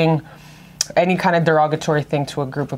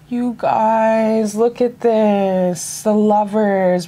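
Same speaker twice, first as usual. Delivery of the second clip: higher, drawn-out amazed exclamation; awed excitement; 5 semitones higher, much slower than usual. Real, not acted. Revealing the Lovers card to viewers, slow and awed. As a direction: stretch each word in wonder.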